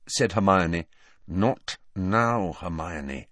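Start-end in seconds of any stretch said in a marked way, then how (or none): 1.3-3.3 darkly significant. Acted. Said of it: "in a darkly significant voice".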